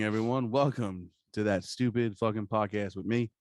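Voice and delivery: ordinary delivery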